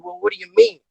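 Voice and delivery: high, puzzled question; a mock-surprised squeak. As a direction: sound genuinely baffled. His own surprised reply quoted.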